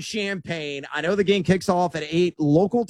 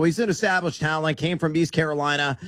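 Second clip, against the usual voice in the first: usual speaking voice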